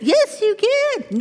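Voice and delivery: high, slow coaxing yes; high slow tempting coax; coaxing tempter. Acted. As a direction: drag the yes out, luring. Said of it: "acted dialogue".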